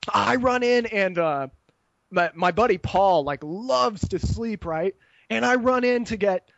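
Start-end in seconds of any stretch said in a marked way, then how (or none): none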